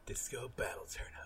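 hushed unvoiced whisper. Real, not acted. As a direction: breathe the name softly. Joking name for the weapon.

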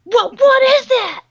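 high, loud mimicked kid shrieks; panicked kids. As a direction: imitate children yelling in alarm. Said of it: "you hear kids are like".

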